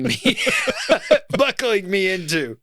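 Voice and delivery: words broken by laughter; laughing through the end. Real, not acted. Laughing at the joke.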